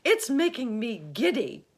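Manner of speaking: high, loud dizzy complaint; giddy Miss Spider. A narrator's reading. Miss Spider complains of the height, high and loud. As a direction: sound giddy and fretful.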